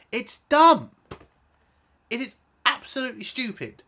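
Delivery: a sudden loud jab of real annoyance; angry outburst. Real, not acted. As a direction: spit out the verdict in disgust. Angry rant about the plot.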